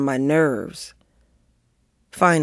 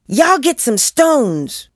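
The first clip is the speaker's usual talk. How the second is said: high, drawn-out commanding call; bossy command; 9 semitones higher, 4 dB louder, slower than usual. A narrator's reading. Joey ordering the other kids to gather stones, commanding. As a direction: order the gang about, stretching the words. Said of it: "commanded Joey now".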